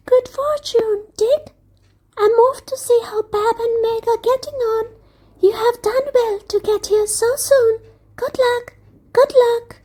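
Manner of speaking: a tiny bug calling out as it flies off; tiny buzzing creature voice. Acted. Busy Buzz cheering Dick on, tiny and high.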